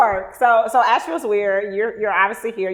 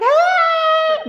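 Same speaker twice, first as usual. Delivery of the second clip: excited hey; 4 dB louder than usual. Retold or imitated. Greeting people as a hugger.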